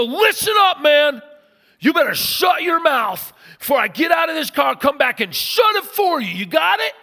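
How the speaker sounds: loud, high-pitched threatening shout; full-volume acted outburst; losing his temper. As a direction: blow up at the other driver. Pastor's angry retort, acted.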